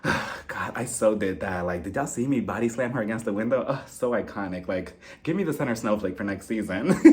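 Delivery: low, hushed self-satisfied gloating; mock self-congratulation, murmured low and soft; smug smirking. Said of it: "She was smiling and smirking and she was like".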